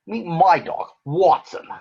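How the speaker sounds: a hint of a proud sleuth; Sherlock voice. Sherlock character voice.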